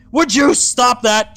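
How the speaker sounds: loud irritated demand; annoyed shout. Acted. Hunk swinging at his teleporting sister, annoyed shout. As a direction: snap at the dodging sister.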